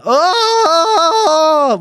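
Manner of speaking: high, loud, drawn-out commentator scream; Gary Neville goal cry. Retold or imitated. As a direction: erupt like a pundit at a famous goal. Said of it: "turned into Gary Neville".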